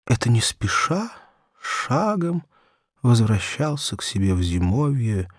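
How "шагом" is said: ordinary delivery, nothing marked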